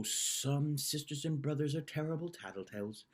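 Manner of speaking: ordinary delivery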